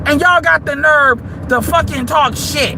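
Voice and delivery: ordinary delivery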